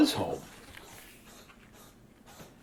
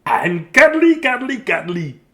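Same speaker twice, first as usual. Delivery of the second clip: high, loud start, then slower and settling; gleeful cry; 8 semitones higher, 6 dB louder than usual. A narrator's reading. Dudley bouncing down to his family, high gleeful cry. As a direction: call it out gleefully.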